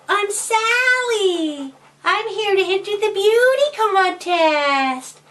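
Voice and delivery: put-on high, stretched-out girly voice; Sally. Boy in disguise introducing himself at the contest, falsetto girly voice. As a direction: put on a boy's fake girl voice. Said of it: "But then he said".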